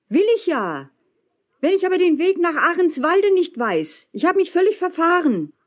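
high, exasperated calling; hinaufgerufen. A narrator's reading. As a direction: call your plight up to the ship. Calling up to man on ship. (verzweifelt mit hoher Stimme rufen)

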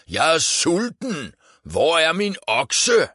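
high, loud hungry Viking bellow; roaring. A narrator's reading. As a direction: roar it out to shake the house. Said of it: "brøler han, så hele huset ryster".

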